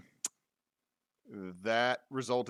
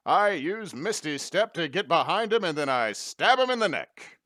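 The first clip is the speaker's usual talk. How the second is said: announcer voice; 7 semitones higher, 9 dB louder than usual. Pretend. Joins the radio host bit.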